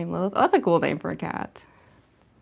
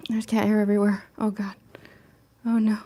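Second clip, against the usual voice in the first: ordinary talk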